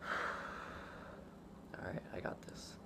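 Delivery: breathy whisper before a dive. Real, not acted. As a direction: whisper it to yourself, psyching up. Bracing before holding breath.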